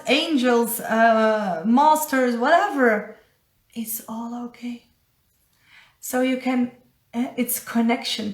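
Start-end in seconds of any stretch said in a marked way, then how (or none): 3.7-4.9 unvoiced reassuring whisper. Real, not acted. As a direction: breathe it out like a secret. Reassuring aside about spirit guides.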